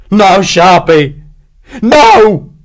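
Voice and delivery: slow, loud, high despairing cry; yelp of despair. Acted. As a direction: howl no in hopeless despair. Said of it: "This last word was a yelp of despair".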